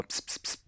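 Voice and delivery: whispered pss of a silencer; soft pss gun sounds; silenced gunshots. Retold or imitated. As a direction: hiss out silenced shots. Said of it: "Toujours des silencieux sur mes fusillades".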